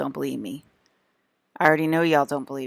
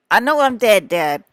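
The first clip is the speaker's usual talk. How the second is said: higher and louder, fed up; exasperated shout; 6 semitones higher, 9 dB louder than usual. Retold or imitated. Answering her dad who keeps saying she's dead, exasperated shout. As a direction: yell it back, exasperated. Snapping back at dad in retold talk.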